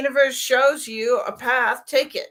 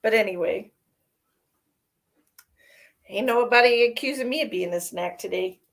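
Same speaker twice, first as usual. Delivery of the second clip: ordinary talk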